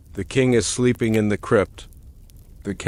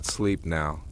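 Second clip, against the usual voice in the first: softly murmured, lower, sinking down; hushed; 4 semitones lower, 7 dB quieter than usual. Acted. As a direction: let it fade to a hush. Lulling listener to sleep.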